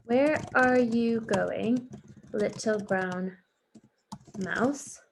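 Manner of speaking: slow, stretched question; slow questioning read. Shown as a demonstration. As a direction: stretch each word out. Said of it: "The question mark".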